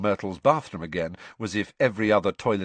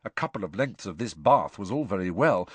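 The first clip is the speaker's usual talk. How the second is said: plain reading voice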